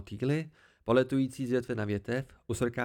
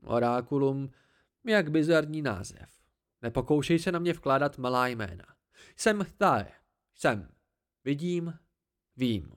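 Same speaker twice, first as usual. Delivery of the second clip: haughty tree creature; 3 dB louder than usual. Acted. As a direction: talk down to the boy from the branches, pleased with yourself.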